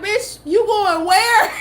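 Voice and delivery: incredulous friends. Retold or imitated. Friends hearing about the Connecticut trip, laughing disbelief. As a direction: drag it out in disbelief, cracking up. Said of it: "They was like".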